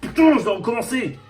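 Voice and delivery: loud, high cry of frustration; exasperated outburst. Real, not acted. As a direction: curse loudly at having to start over. Exasperated at having to restart.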